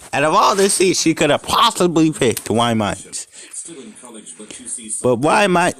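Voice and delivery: plain reading voice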